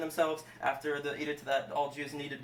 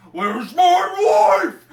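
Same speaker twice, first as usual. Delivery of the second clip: drawn-out high shout; king's bellow; 13 semitones higher, 17 dB louder, much slower than usual. Acted. King demanding his missing queen, hammed-up bellow. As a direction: bellow it slowly, stretching every word.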